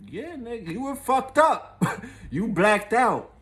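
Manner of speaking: high, slow teasing laugh-talk; laughing coworker. Retold or imitated. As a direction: voice her cracking up as she mocks him.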